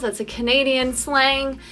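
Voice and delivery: ordinary talk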